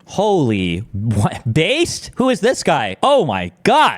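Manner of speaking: real shock, voice jumping up; stunned surprise. Real, not acted. Streamer shocked by general's demands.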